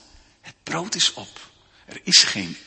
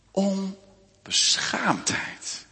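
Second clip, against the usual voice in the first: onbeschaamdheid stretched very slowly and softer; drawn-out emphasis; 5 semitones higher, 7 dB quieter, much slower than usual. Acted. Preacher lingers slowly over onbeschaamdheid for his congregation. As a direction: let onbeschaamdheid hang, word by word. Preacher dwells on key word.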